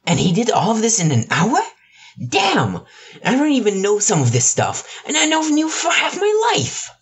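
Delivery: high, stunned husband in growing dismay; shocked husband. Acted. Hisashi voiced by narrator.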